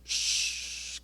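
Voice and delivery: soft breathy shh, almost silent; gentle fading shh; hushing shush. Shown as a demonstration. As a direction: hush like a sea going still. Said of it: "¿Y qué hizo el mar?".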